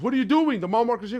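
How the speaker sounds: high, loud acted complaint; retold soldier's indignant outburst; exasperated protest.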